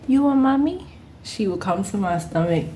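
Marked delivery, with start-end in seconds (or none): 0.1-0.9 put-on high, sweet toddler asking; little girl voice. Toddler asking the pregnant worker about her belly, high and sweet. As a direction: ask it like a small child, higher. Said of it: "She was like".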